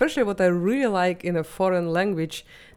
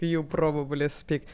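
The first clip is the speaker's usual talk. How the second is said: heavier Russian accent; 3 semitones lower, 5 dB quieter than usual. Shown as a demonstration. Guest shows the host her stronger Russian accent. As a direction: lean harder into the Russian accent. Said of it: "I can go even more harder with my accent".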